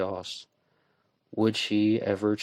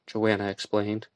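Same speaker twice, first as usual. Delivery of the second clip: usual speaking voice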